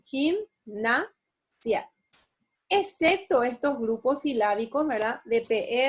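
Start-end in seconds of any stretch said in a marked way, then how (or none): 0.0-1.9 "gimnasia" slowly split as gim, na, sia; syllable by syllable. Shown as a demonstration. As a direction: pause between each syllable of "gimnasia". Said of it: "Y tengo la separación".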